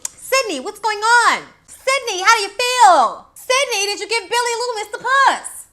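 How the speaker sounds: high, loud pushy reporter voice; imitating the pushy reporter shouting questions; mock Gail Weathers. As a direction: hound her with rapid questions. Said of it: "She was".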